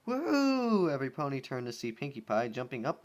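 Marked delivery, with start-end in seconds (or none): 0.1-0.9 high, loud cheering joy; gleeful. Acted. Rainbow celebrates the melon saying yes, gleeful. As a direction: whoop it out with glee. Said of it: "lit up with glee".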